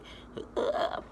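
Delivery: mock a voice cracking; wordless broken-voice noise; imitated voice crack. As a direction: wobbly croak mimicking a cracking voice. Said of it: "it was cracking, like, all crazy".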